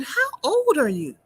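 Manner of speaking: ordinary talk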